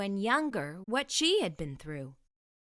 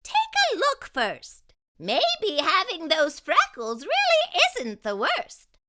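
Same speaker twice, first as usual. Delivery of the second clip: very high, louder fairy with a laugh; playful magical fairy teasing gently; 7 semitones higher, 6 dB louder than usual. Acted. Fairy coaxing Jessie to look, high playful voice. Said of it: "asked the fairy".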